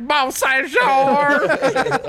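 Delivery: very slow, high Texas twang on Beausejour; hammed-up Texas twang; Texas accent. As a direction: stretch Beausejour out like a Texan. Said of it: "and so I was like".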